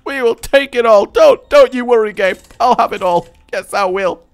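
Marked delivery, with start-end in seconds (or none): none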